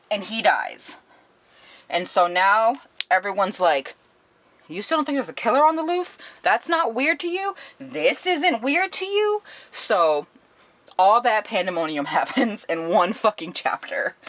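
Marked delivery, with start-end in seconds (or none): 4.7-9.4 very high, rising disbelief; incredulous challenge. Retold or imitated. Residents confronting the director about a killer, incredulous. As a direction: pile up indignant questions, pitch climbing.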